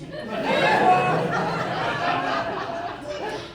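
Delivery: shrieking laugh, breathless; burst of high laughter. Real, not acted. Uncle guesses the secret.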